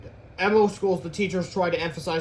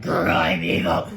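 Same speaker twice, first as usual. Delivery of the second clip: very low, slow, loud monster voice; hammed-up deep villain; evil villain; 10 semitones lower, 6 dB louder, slower than usual. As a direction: drag it out deep and menacing. Comic villain line in growl.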